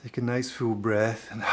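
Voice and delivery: calm, unhurried delivery with long pauses; guided meditation. Real, not acted.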